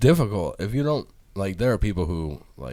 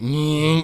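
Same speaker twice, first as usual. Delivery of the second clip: quick, higher mock buzz of clippers; 8 semitones higher, 6 dB louder than usual. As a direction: mimic the clippers biting in. Wife imitates the clippers.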